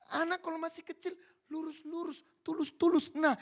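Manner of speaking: normal talking voice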